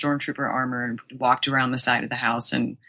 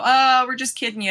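higher-pitched, lightly mocking brush-off; imitated teasing; 8 semitones higher than usual. Friends pranking the wedding planner, lightly teasing. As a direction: toss it off like a prankster.

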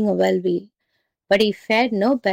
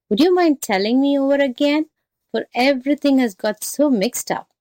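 higher, lilting muddled jackal; feigned confusion; 5 semitones higher than usual. Acted. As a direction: play the baffled jackal asking for help. Jackal feigns confusion to trick tiger.

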